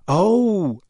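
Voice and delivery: lifted, dawning realisation; cottoning on. A narrator's reading. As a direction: let the hint sink in. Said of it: "cottoning on".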